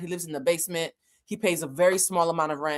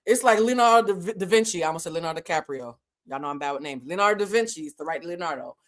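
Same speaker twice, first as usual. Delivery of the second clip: usual speaking voice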